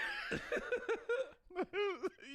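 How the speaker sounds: long, very high laughing fit; high, drawn-out cracking up; laughter. Real, not acted. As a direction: squeal with helpless laughter. Cracking up at spilled drink.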